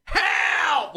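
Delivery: high, loud mock cry for help; imitated scream for help. Woman calling into the night, imitated scream. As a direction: shriek it like the woman heard far away. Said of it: "I fucking heard, like, a woman scream".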